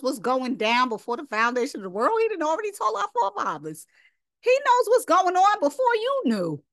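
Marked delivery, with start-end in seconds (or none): 2.2-6.6 fervent rising preaching. Real, not acted. Preacher stressing God's foreknowledge.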